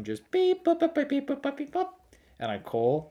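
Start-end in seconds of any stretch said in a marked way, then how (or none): 0.3-1.9 high-pitched keypad beeping; sing-song dialling noises; imitated dial beeps. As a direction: mimic phone buttons in squeaky little beeps. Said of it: "I would just type in strange numbers on my phone".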